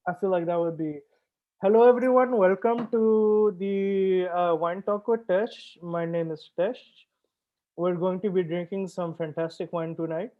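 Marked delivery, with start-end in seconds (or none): 1.6-10.0 light Indian accent greeting; playful Indian accent, slow and warm. Shown as a demonstration. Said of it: "with my Indian accent this time?".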